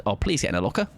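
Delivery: ordinary talk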